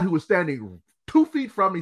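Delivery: ordinary talk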